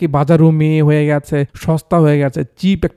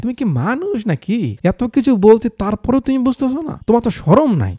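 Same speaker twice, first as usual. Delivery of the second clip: higher, exasperated scolding; angry scolding wife; 7 semitones higher than usual. Acted. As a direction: scold your husband in disbelief. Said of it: "আন্টির মাথা গরম হয়ে যায়".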